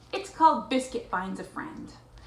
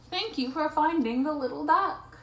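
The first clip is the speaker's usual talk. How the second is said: higher grateful duck voice; lightly voiced ducks; 7 semitones higher, 3 dB louder than usual. A narrator's reading. Ducks thanking the puppy for finding their duckling, lightly voiced. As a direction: thank them in a slightly raised character voice. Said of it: "The ducks say thank you".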